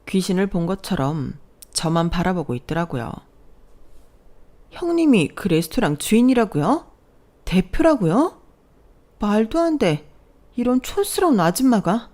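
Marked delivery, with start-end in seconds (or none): 4.7-12.1 high, stunned questioning; can't believe what she's hearing; sister-in-law learning the frumpy woman owns the restaurant; shocked disbelief. Acted.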